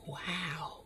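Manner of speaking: very soft awed wow; stunned wow. Retold or imitated. Speaker hearing of a book deal, stunned soft wow. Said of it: "I was like".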